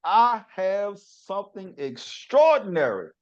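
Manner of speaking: high, loud, stretched-out host greeting; hyped show opening. Acted. Host greeting listeners at the top of the podcast, hyped. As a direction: kick off the show with big energy.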